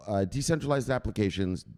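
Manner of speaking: normal talking voice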